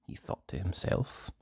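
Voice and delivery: soft whisper; whispered aside. A narrator's reading. Narrator whispering Nosey's thought.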